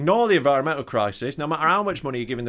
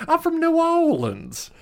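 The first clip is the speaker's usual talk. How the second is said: much higher, slow mock New Orleans accent; hammed-up New Orleans accent; slower than usual. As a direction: stretch it out high in a silly New Orleans voice. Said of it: "It's not that bad".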